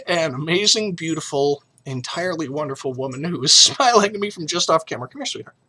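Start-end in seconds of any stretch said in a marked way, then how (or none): 5.1-5.6 high, soft coaxing call to a loved one. Real, not acted. Husband gently coaxing his wife into the camera frame, softly. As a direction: sweetly coax her to come over.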